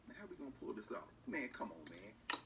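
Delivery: low-pitched, lightly acted private grumble; backroom aside. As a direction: drop the public act and talk privately. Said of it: "go back in the room and you say".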